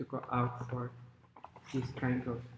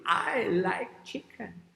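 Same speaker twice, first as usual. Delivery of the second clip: slow, higher relishing voice; hungry fox; 5 semitones higher, slower than usual. Acted. Fox spots the chickens, slow high relish. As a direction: savour the words slowly as the fox. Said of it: "And the fox says".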